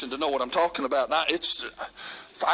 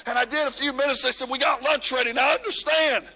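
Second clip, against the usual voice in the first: full-force preaching; 9 semitones higher than usual. Acted. Preacher telling the congregation his mission story, preaching at full force. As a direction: preach it out to the congregation, riding the music.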